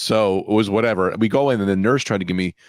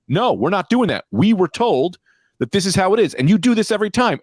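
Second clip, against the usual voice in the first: indignant retort; 7 semitones higher than usual. Retold or imitated. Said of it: "And so I go".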